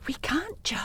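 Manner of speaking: whispered. Acted. Hushed worry.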